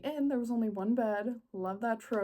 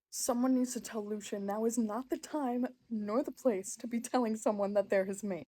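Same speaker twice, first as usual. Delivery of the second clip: ordinary talk, nothing marked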